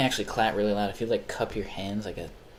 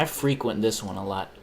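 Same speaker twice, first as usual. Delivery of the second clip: ordinary talk, nothing marked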